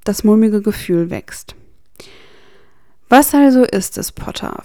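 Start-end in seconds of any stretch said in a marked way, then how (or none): 3.1-4.6 mocking smirk. A narrator's reading. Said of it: "mit einem spöttischen Lächeln".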